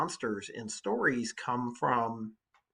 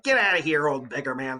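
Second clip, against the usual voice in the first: mock rude shout; 2 semitones higher, 8 dB louder than usual. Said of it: "you're like".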